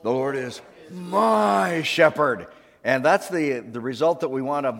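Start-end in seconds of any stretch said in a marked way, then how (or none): 0.0-2.5 slow, loud, dramatic, my stretched; with more drama. Shown as a demonstration. Pastor asking more drama, slow loud proclamation. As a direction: really proclaim it, big and slow. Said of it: "Do that with more drama".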